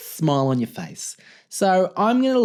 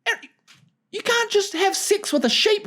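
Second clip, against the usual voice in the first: quoting everyone; 14 semitones higher than usual. Retold or imitated. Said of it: "And everyone's like".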